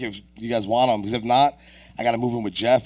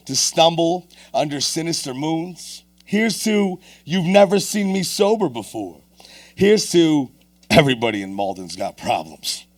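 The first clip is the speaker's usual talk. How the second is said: performed poetry recitation; spoken-word poem; 5 semitones higher, 3 dB louder, slower than usual. Acted. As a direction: slam poem toast in rhythmic, raised voice. Recites a toast poem on stage.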